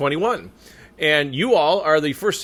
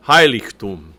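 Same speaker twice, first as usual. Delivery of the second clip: HEI-ligtum, first syllable of "Heiligtum" punched louder; stress on first syllable; 5 semitones lower, 6 dB louder than usual. Shown as a demonstration. Coach showing the accent, "Heiligtum" stressed on its first syllable. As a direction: hit "Hei" in "Heiligtum", then fall away. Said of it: "the accent is on that first syllable".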